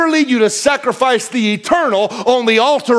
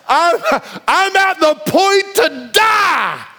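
higher mock wail of a starving man; melodramatic Esau; 8 semitones higher, slower than usual. Esau begging Jacob for stew, mock dramatic wail. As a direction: overplay the dying cry for laughs. Esau's dramatic hungry complaint.

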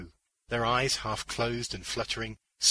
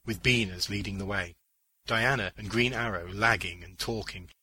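plain reading voice

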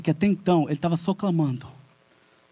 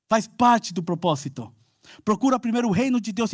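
higher, intense preaching; full-force preaching; 7 semitones higher than usual. Real, not acted. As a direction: preach it hard to the church.

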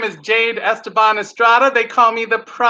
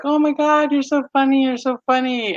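high, giddy mock admiration; imitated gushing; 6 semitones higher than usual. New boyfriend adoring the comedian, imitated gushing. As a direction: gush in a raised, smitten voice.

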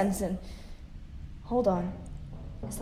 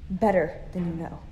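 ordinary talk

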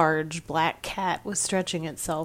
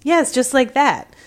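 louder, eager confirmation; excited; 8 semitones higher, 9 dB louder than usual. A narrator's reading. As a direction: jump on the cat's echo.

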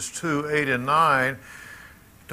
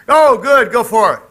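much higher and louder cheer; delighted encouragement; 9 semitones higher, 13 dB louder than usual. Real, not acted. Preacher cheering on an older lady who volunteers to read, delighted and loud.

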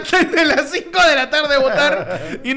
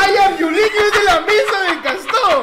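loud, high, laughing call; laughing shout; 9 semitones higher, 7 dB louder than usual. Real, not acted. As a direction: burst out laughing and calling. Laughing outburst at polling table. (grito entre risas)

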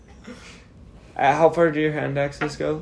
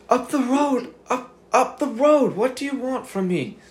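much higher, panicky pleading voice; frightened captive; 11 semitones higher than usual. Acted. GM voices hooded Sedona begging his captor. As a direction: plead like a bagged, terrified prisoner. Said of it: "he goes, he goes".

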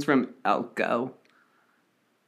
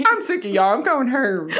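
high granny voice; quavery old lady saying going Herm; man doing a woman's voice; 10 semitones higher, 3 dB louder, faster than usual. Pretend. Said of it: "Sounds like an old lady, a granny".

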